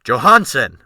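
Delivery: loud, brisk call across the deck. A narrator's reading. Captain calling his new mate across the deck, loud brisk call. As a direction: snap the name out over the wind. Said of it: "Wolf Larsen said briskly to the new mate".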